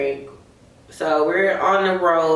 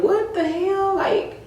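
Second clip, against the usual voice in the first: high disbelieving exclaim; mock outrage at the locked door; shocked; 13 semitones higher, slower than usual. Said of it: "we're like".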